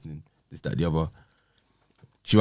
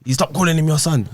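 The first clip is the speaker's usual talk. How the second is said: higher, louder mock retort; ex's sharp reply, raised; imitating her snap; 9 semitones higher, 4 dB louder than usual. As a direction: throw her line back with attitude. Retold ex's snapping line.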